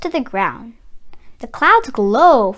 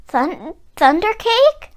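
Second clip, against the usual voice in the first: stammering; 8 semitones higher than usual. A narrator's reading. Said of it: "I stammered".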